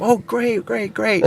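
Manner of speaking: high, sugary customer voice; so sweet. Retold or imitated. Customer hearing the price at first, sweetly high imitation. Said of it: "she was so sweet".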